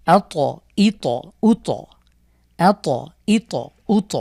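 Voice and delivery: each ত bounced after আ, ই, উ; qalqalah drill. Shown as a demonstration. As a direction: bounce every ত in turn. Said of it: "তাহলে একবার মিলিয়ে পড়ব আমরা".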